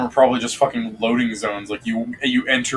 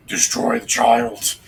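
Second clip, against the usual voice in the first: slow breathy demon whisper; demonic whisper; much slower than usual. Retold or imitated. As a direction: hiss it out like a dark spirit. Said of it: "I like [name]'s interpretation of your voice".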